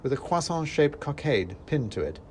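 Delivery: ordinary delivery, nothing marked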